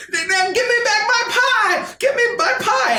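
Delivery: put-on high, loud angry old man; high, shouted old-man demand; old baker yelling. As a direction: shake your cane and demand the pie.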